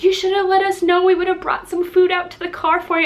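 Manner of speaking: fretting apology from the owner; quoting the owner. Retold or imitated. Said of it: "the poor owner's like".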